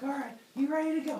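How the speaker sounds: fast high pep-talk voice; hype trainer. Retold or imitated. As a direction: pump them up like a coach. Trainer pumping up weavers.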